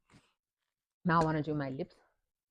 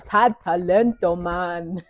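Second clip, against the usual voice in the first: excited; 3 semitones higher, 10 dB louder, much slower than usual. Real, not acted. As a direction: hype it up, slow and loud. Excited praise in isiZulu.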